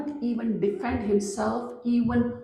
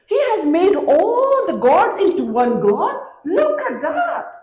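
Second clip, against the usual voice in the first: put-on old woman's voice, higher and louder, insistent; a shrill-pitched old lady warning him off, much higher; old woman ranting; 7 semitones higher, 6 dB louder than usual. Old woman warns the stranger against the prophet, high and loud.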